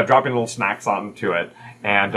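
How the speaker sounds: ordinary talk, nothing marked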